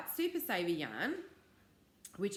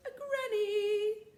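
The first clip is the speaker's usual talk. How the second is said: excited reveal; 4 dB louder, much slower than usual. Real, not acted. Revealing her granny blanket.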